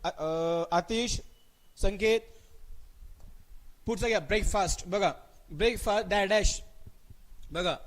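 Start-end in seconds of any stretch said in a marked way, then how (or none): none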